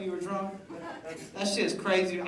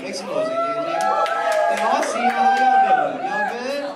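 hype man; 4 dB louder than usual. Real, not acted. Hyping up the Indian crowd.

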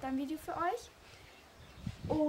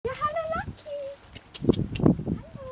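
high cooing greeting; baby talk; 12 semitones higher, 6 dB louder than usual. Acted. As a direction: coo at the horse.

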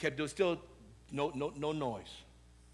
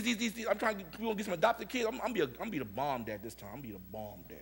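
usual speaking voice

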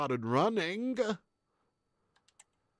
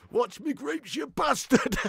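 high, loud put-on voice; high-pitched mock outrage; comic yell; 11 semitones higher, 4 dB louder, slower than usual. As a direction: bellow it like a cartoon yokel. Comic character yelling.

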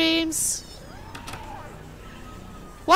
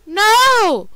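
alarmed shout; 6 semitones higher, 7 dB louder than usual. Real, not acted. Horror game scare.

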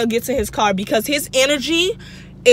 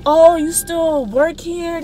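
slow, lightly put-on man's voice, high; imitating the man; 6 semitones higher, slower than usual. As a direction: drag out his fake friendly greeting. Imitates the man greeting her.

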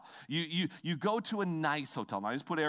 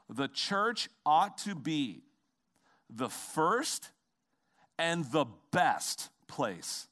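slow deliberate proclamation; much slower than usual. Acted. Slow weighty declaration of belief.

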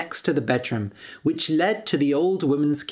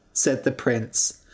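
ordinary delivery

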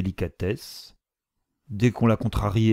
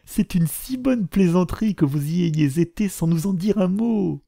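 delighted mother; 7 semitones higher than usual. A narrator's reading. The mother thanks her husband, high and gushing. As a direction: gush with joy at her husband.

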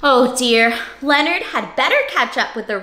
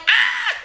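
mock scream. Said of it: "His family's like".

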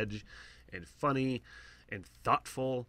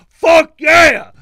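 huge high excited yell; cheering shout; 25 dB louder than usual. Retold or imitated. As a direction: cheer it out like a fist pump. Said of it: "and I'm like".

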